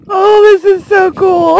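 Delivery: real delighted gush; excited squeal. Real, not acted. Grasshopper climbs onto her hand.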